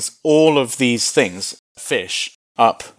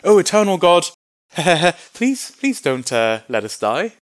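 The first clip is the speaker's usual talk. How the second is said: mock pleading; 5 semitones higher than usual. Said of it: "Some sort of pleading with God".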